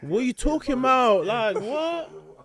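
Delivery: high, loud, laughing disbelief; incredulous disbelief. Retold or imitated. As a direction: squeak it out in mock outrage, laughing.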